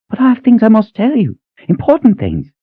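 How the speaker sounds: put-on high old voice, urgent plea; insistent old cook. Cook urging Kaelin to hear important news, high insistent plea.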